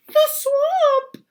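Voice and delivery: very high, loud shriek; terrified kids. Acted. Kids recognising the swamp, high terrified shriek. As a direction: shriek it in terror. Kids' fright.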